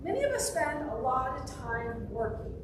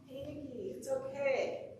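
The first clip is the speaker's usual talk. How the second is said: soft, tender comforting; gentle reassurance; 7 dB quieter than usual. Retold or imitated. Talking to her twenty-year-old self, gentle reassurance. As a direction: reassure softly, as to your younger self.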